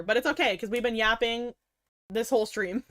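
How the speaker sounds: usual speaking voice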